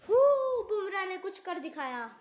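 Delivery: ordinary talk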